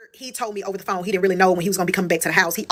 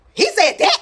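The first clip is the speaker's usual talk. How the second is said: shocked question; 13 semitones higher, slower than usual. Retold or imitated. Mother-in-law shocked by gossip.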